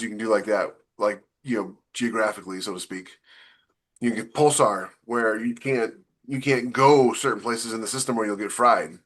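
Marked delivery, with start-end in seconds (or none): none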